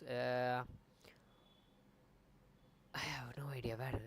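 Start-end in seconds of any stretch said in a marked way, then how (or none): 2.9-4.0 breathy whisper under his breath; whispered aside. Real, not acted. Streamer whispering to himself, lost, a quiet whisper. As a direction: let it slip out as a whisper.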